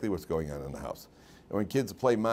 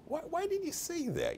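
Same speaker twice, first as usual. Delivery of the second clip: exasperated principal; 7 dB quieter than usual. Retold or imitated. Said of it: "he's like".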